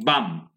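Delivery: বাম with an open "a" and silent final b; open-vowel pronunciation. Shown as a demonstration. Teacher showing how it should be pronounced, open-vowel pronunciation. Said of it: "আপনি উচ্চারণ করবে কি?".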